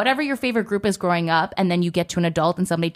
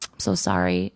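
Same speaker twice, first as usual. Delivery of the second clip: sympathetic manager; 12 semitones lower than usual. Retold or imitated. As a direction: drop it low and sorry.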